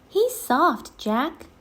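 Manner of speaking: put-on high, bright excited girl; high excited kid sister; Annie's voice. A narrator's reading. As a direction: lift it up into an eager little girl.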